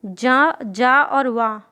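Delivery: very slow जा और वा, each part held; patient teacher breaking a letter into sounds; slow spelling out. Shown as a demonstration. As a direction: lay out the sounds one by one. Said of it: "ध्वनि निकल रही".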